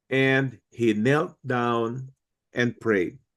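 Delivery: slow, weighty reading of each word; emphasized quote. Shown as a demonstration. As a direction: lean on "knelt" and let the phrase drag slowly. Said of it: "I would like to emphasize the words".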